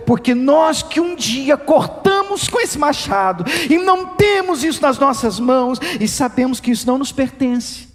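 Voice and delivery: normal talking voice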